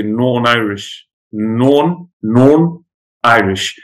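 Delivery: Northern Irish "Norn", TH swallowed into one syllable; Northern Irish accent. Shown as a demonstration. Showing students the dropped TH, Northern Irish accent. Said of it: "if you're Northern Irish".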